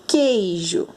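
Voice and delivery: "Queijo" said slowly, no u after the k; silent U. Shown as a demonstration. Teacher has pupils pronounce along, silent U. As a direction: model "Queijo" clearly as KAY-zhu. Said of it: "pronuncia aí com a Pro".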